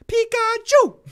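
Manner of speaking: high, loud squeaky cry; Pikachu voice. Acted. Sneeze turned into Pikachu.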